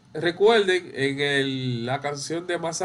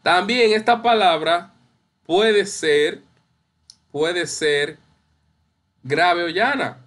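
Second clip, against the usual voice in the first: ordinary delivery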